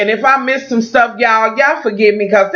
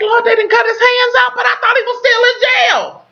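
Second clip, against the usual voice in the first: shocked outcry. Retold or imitated. Recapping her own reaction to the twist, a high shocked shout. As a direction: cry out in shock at the screen. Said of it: "And I'm like".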